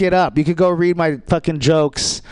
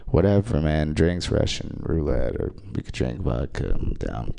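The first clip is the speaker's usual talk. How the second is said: low, fading, ever softer mumble; mumbled deadpan reply; 12 semitones lower, 6 dB quieter than usual. Retold or imitated. Answering an ex's drinks invite with a fading deadpan mutter. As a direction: let it sink to a bored mutter. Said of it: "And I'm like".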